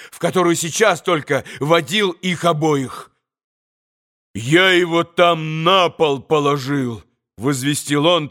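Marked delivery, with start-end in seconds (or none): none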